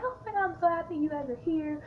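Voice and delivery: high, delighted gush; excited greeting. Retold or imitated. Greeting long-unseen friends at a reunion, high and excited. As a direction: imitate a thrilled hello to old friends.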